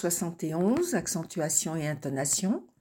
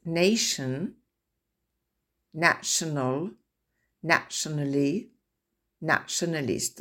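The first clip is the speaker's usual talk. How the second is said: nation, national, nationally rising, nationalist falling; repeated stress model; word-stress drill. Shown as a demonstration. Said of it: "Je répète".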